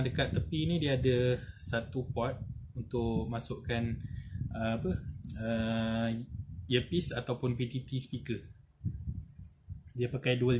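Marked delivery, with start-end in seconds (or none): none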